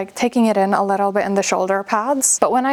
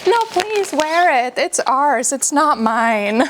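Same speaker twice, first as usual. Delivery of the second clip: mom's pleading voice, higher and slower at the end; mock mom insisting sweetly, pitched up; imitating mom; 5 semitones higher than usual. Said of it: "She's like".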